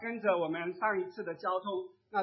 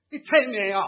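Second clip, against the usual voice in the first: loud indignant outburst at a passerby; much louder, abrupt scolding; 3 semitones higher, 13 dB louder than usual. Retold or imitated. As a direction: shout it as an angry rebuke. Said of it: "却大声对他讲".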